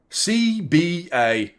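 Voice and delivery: "C B A" letter by letter, slow and deliberate; spelled out slowly. Shown as a demonstration. Streamer mocking Salah's effort, spelling out slowly. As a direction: spell "C B A" out like a shirt name. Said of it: "changed the word Doku to C B A".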